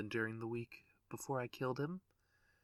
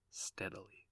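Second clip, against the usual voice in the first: soft whispered echo; 3 semitones lower, 9 dB quieter than usual. A narrator's reading. Narrator creeping the door open, whispering. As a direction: let it fade into a whisper.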